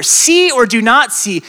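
ordinary delivery

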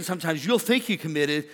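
ordinary talk

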